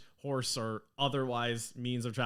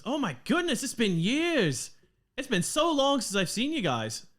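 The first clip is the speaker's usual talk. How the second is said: much higher, louder Australian reunion; excited Australian dwarf; 11 semitones higher, 4 dB louder than usual. Acted. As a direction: gush like an Aussie seeing old friends. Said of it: "her accent has always been the same".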